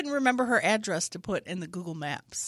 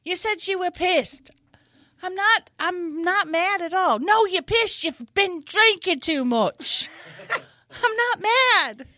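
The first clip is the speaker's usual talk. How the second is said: mock quarrel; 9 semitones higher, 6 dB louder than usual. Acted tea quarrel.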